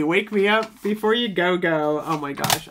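ordinary delivery

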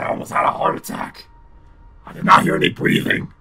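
ordinary delivery

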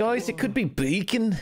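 high, slow mock Scouse; put-on Liverpool accent. Player teasing the group about who did it, mock Liverpool accent. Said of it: "What is that accent?".

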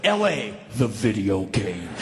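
low, slow announcer voice; game title announcer. Retold or imitated. Comparing the chase to a video game, low announcer voice. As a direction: drop way down and draw out the title.

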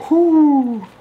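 a high, loud whoop of delight; real excited whoop; excited whoo. Real, not acted. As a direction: let out a big happy whoo. Trying on comfy headphones.

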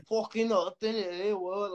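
high-pitched mock Irish mumbo-jumbo; incomprehensible Irish talk. Boxer mimicking an Irish guy's baffling answer, high mock Irish. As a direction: slur it together like an imitated Irish reply. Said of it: "I can never understand shit they say".

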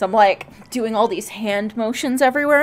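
usual speaking voice

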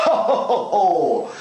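high laughing ho ho ho; hearty chuckle. Real, not acted. Modeller chuckling at his own peg trick. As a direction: chuckle it out merrily.

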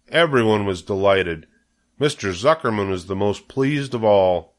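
plain reading voice

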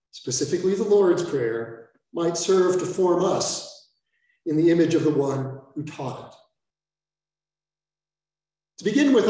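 ordinary talk

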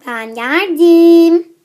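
slow, loud drawn-out announcement; grand entrance. Acted. Girl arriving and announcing herself, slow and loud. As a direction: stretch it out like a proud entrance.

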